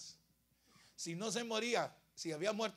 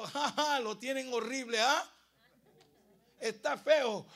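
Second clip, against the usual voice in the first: lightly voiced taunting Death, raised voice; mocking Death; 6 semitones higher, 9 dB louder than usual. Death talking to the devil, lightly acted taunt. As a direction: tease with a spoken ha-ha. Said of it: "la muerte diciéndole al diablo".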